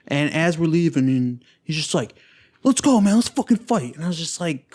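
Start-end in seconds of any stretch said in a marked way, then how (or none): 2.6-4.0 high, pushed mock aggression; retold fight challenge. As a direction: act out a guy itching to fight. Angry guy challenges them to fight.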